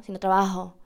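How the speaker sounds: "trabajo" with a barely-there j. Shown as a demonstration. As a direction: breathe the j in "trabajo".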